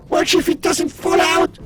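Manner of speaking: normal talking voice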